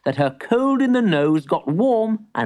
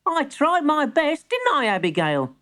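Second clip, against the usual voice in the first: put-on boy voice; 13 semitones higher than usual. Boy pleads.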